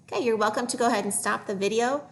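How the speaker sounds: ordinary talk, nothing marked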